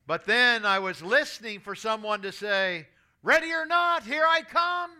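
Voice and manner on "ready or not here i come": high, loud singsong call of a kid playing hide and seek. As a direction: call it out across the cornfield, teasing.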